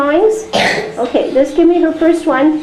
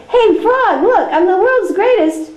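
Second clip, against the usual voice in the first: higher, boastful show-off calling for attention; bragging Toad; 6 semitones higher than usual. Pretend. Toad shows off to Frog, higher boastful brag. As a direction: look at me, I'm the best, much higher.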